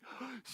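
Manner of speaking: soft excited intake of breath; quick breathless gasp of joy; delighted gasp. Retold or imitated. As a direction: gasp like you just heard her come in. Hears wife down the hallway.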